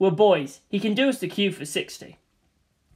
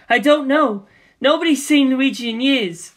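higher and louder, scared and pleading; panicked denial; 6 semitones higher, 5 dB louder than usual. Acted. Daisy to Waluigi at gunpoint, panicked. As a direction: cry out that you don't know.

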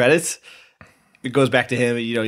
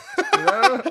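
high burst of laughter. Real, not acted. Laughing at the movie.

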